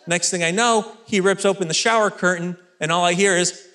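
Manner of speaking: ordinary talk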